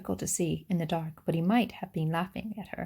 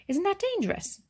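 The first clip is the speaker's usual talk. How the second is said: squeaky fake casual. Sophie tries to sound casual to the roof boy, voice leaping high. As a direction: voice jumps much higher, trying to sound casual.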